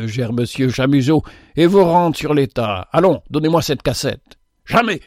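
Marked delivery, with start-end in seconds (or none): 4.6-5.0 high, sharp cry of refusal; shouted refusal. A narrator's reading. The two misers shout at the visitor, high cry. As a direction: fling it out in panic. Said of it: "crièrent à la fois les deux concierges".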